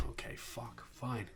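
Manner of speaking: whispered, resigned curse; grumbling after being called back; under-breath curse. Real, not acted. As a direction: mutter it so nobody hears.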